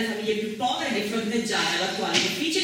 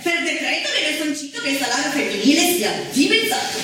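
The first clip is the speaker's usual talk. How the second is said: loud, ringing announcement of each decree; loud proclamation; 8 semitones higher, 5 dB louder than usual. Acted. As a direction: proclaim each date like a town crier.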